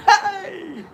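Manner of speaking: high, loud "hey" to get a laugh; shrill-pitched clowning yell; mock greeting. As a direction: shout it high and goofy at a guard. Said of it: "I went like this to him".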